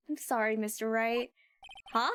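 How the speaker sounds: ordinary talk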